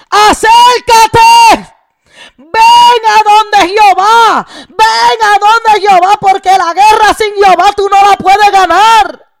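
full-force preaching shout; urgent altar call. Real, not acted. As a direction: cry out to the crowd to come near. Preacher calls people to come.

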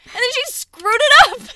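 high, loud accusation; exasperated shout. Real, not acted. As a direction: burst out in loud complaint. Playful quarrel over botched nails.

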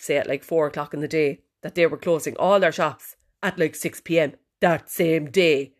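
ordinary delivery

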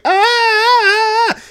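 long, high, loud scream; imitated scream. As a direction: let out a sustained piercing scream, imitating the character. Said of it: "it was, like, screaming".

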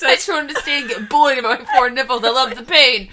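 low, fast parody voice with laughter; mock Harry voice. Friend mockingly voices Harry's confession, mock deep voice.

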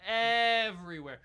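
high, loud, worked-up storytelling; hammed-up rant. Hammed-up car repair rant.

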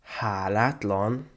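drawn-out, rising question; slow, incredulous repeat of the word; offended echo. A narrator's reading. Girl repeats the student's insult, offended.

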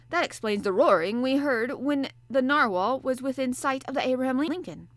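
a sleepy sailor answering the professor; gruff harpooner. Acted. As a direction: play the bluff Canadian harpooner, hearty and loud. Ned Land voiced.

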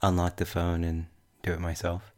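normal talking voice

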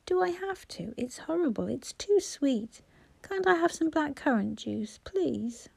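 put-on high, plaintive child voice; whining little boy. A narrator's reading. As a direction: plead like a small child who hates the drink. Voices the small boy complaining.

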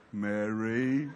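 very low, stretched name with a rising end; drawn-out Mary. Shown as a demonstration. Jesus might have greeted Mary so, low and drawn out. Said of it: "Or did he say".

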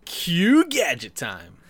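slow, loud segment announcement; announcer voice. Acted. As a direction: boom it out like a show title.

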